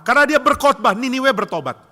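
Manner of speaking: loud, high preacher's outburst; indignant exclamation. Retold or imitated. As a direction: hammer out the absurd reason. Preacher dramatizes Jonah's anger.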